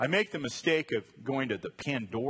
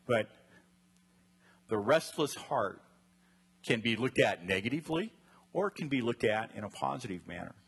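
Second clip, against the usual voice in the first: ordinary delivery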